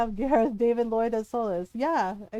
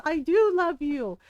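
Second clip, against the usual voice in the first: higher, louder, drawn-out protest; Solus protesting; 5 semitones higher, 5 dB louder, slower than usual. Retold or imitated. Solus answering Llewellyn's accusation, imitated slow pleading protest. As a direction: insist on it slowly, raised voice. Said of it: "you can hear his voice".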